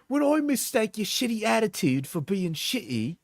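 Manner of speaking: mocking voice. Mock partner message.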